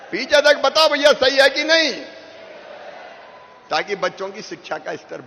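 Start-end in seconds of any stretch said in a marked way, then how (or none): 0.1-1.9 loud, higher call to the far crowd; loud, higher rally call to the back rows. Real, not acted. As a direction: reach the people at the very back.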